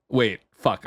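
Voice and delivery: usual speaking voice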